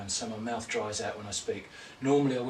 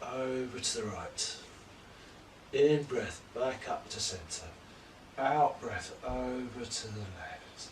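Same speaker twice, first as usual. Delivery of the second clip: calm, measured yoga-teacher cues timed to the breath; guided yoga instruction; 2 semitones higher than usual. Acted. Teacher guides home class through poses, calm paced cues. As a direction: talk the class through each pose breath by breath.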